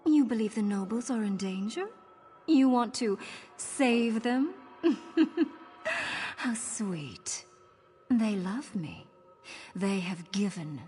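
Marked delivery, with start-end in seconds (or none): 4.8-5.6 short, high villainous laugh; amused little laugh of scorn; mocking chuckle. Lady scoffs at hero saving the nobles, mocking laugh.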